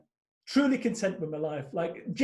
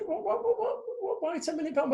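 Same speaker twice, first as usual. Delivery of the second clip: high, incredulous what-what-what; stunned disbelief; 10 semitones higher, 3 dB quieter than usual. Retold or imitated. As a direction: act floored by the number. Retelling his stunned reply.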